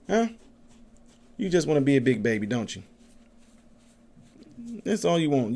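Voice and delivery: gentle doggy-talk. Real, not acted. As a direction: coo at the puppy. Baby talk to puppy.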